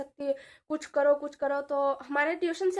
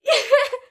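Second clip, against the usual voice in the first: high, loud laugh breaking out; a sudden real laugh; loud burst of laughter; 9 semitones higher, 10 dB louder than usual. Real, not acted. Laughs at her own story.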